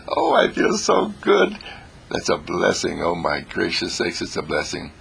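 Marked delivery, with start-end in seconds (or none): 0.1-1.6 delighted. Retold or imitated. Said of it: "I say to myself".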